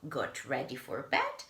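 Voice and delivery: plain reading voice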